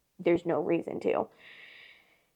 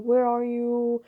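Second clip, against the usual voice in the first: drawn-out, higher mock call; slow, raised-pitch retold plea; imitated calling out; 4 semitones higher, 4 dB louder, much slower than usual. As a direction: stretch it out like calling after someone. Retold calling after the runaway boyfriend.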